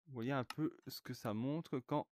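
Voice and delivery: ordinary delivery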